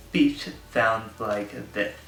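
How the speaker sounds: "speech" and "this" lisped, tongue between the teeth; slow, lisping "like this" as th sounds; thick lisp. Shown as a demonstration. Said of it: "if I am talking and I have an interdental lisp".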